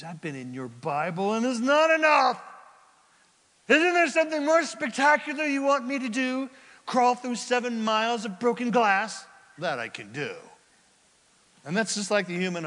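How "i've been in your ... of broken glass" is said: demanding complaint. Acted. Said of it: "you're gonna go".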